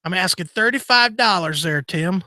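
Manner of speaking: high-pitched mock caller; imagined caller Tim. Host imitates Tim haggling on Tradio, mock caller. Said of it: "I can hear Tim calling up".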